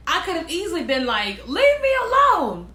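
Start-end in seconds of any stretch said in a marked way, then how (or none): 1.5-2.7 loud, high-pitched hammed-up rebuff; mock indignant yell. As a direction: yell it like fending off a pest, playfully overdone. Hypothetical angry rebuff, acted out.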